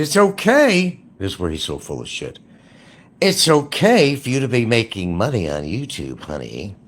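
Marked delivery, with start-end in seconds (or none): 0.0-1.0 mock lawyer. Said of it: "She said". 3.2-6.8 high, loud sugary mock voice; mocking the lawyer. Mocking his female lawyer, high sugary parody voice. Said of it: "She said".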